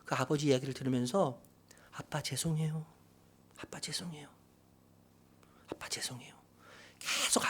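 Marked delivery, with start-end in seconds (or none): 1.9-6.3 very soft, fading into a whisper; meek little apology, barely audible; hushed son apologizing to his father; apologetic child. Retold or imitated. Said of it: "그랬더니 이 아이가요".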